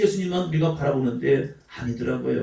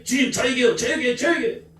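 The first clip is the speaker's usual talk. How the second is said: pleading believer; 6 semitones higher than usual. Pretend. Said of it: "mock pleading believer".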